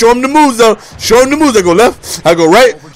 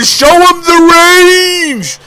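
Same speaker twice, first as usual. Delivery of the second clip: hyped drawn-out call; 8 semitones higher, much slower than usual. Real, not acted. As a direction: sing it out like a hype man. Hyping his own shot.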